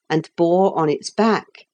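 ordinary delivery, nothing marked

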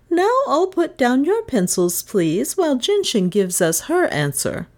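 high-pitched classroom instruction; teacher instructing. Acted. Miss Todd calls time on the class, instructing. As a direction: start high, address the whole class.

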